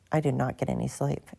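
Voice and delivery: ordinary talk, nothing marked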